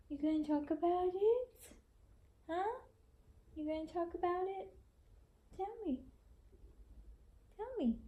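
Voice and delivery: high, cooing questions to a newborn. Real, not acted. Mom coos to newborn baby.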